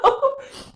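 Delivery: squealing high laugh; high burst of laughter. Real, not acted. Medium cracking up at the spirit's leprechaun joke, high laugh. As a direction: let a sudden high giggle burst out.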